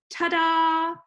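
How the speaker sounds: "ta-da" with short "ta", long high loud "da"; stress on DA. Shown as a demonstration. Teacher demonstrating an iamb, "da" stressed and stretched in "ta-da". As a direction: land hard and long on the "da" of "ta-da". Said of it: "short unstressed syllable and a longer stressed syllable".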